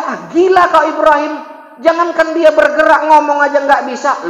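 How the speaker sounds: high, loud scornful retort; scoffing king. Acted. King scoffs at Ibrahim, high scornful retort. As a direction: brush off the boy as mad.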